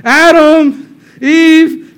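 high, loud searching call; calling out names. Acted. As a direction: call their names out across the garden. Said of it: "calling out their names".

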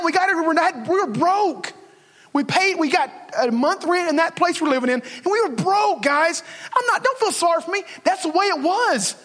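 preaching at full force. Acted. Pastor testifying to his congregation about being broke, preaching at full force.

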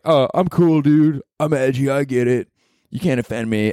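a touch of edgy comic bravado; cocky New York comic. Retold or imitated. Comic insisting nothing can offend him, lightly mocking cockiness. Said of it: "you think as a comic in New York".

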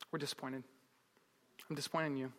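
very soft, low and let down; grave parental disappointment. Retold or imitated. Parents to child caught in a lie, hushed disappointment. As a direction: drop the voice, heavy with disappointment.

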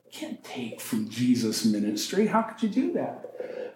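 low quiet rebuke of the inner voice; inner voice of conscience. Retold or imitated. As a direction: hush it like conscience prodding. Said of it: "this kind of prodding in his heart".

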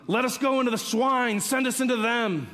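high, urgent reading of a begging plea; pleading demons. Acted.

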